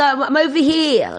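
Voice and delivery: high call back across a shop; imitated calling back. Answering mum's call across shop.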